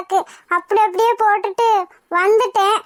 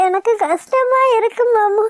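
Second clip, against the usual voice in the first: crying. Acted. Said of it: "அப்புறம் ஏன் இன்னும் அழுதுட்டு இருக்க?".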